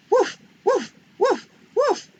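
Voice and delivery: high, loud yappy puppy woofs; puppy bark. Acted. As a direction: bark like a small eager puppy. Puppy barks.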